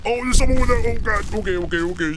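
sudden high-pitched alarm; startled panic. Real, not acted. Player spots someone in a horror game, startled panic. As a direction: blurt it out in fright, high then easing.

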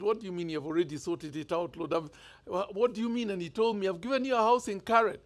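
usual speaking voice

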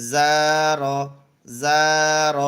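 teacher models the word for the class to repeat; emphatic demo. Shown as a demonstration. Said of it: "আবার জা খালি".

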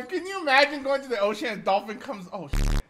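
ordinary talk

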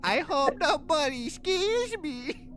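high, slow whimpering fake fear; mock scared whimper. Husband hiding on the bed, hammed-up scared whimper. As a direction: quaver it like a frightened child under covers. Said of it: "with my eyes peeking out going".

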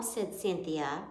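ordinary delivery

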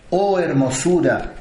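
ordinary delivery

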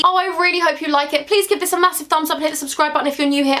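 ordinary talk

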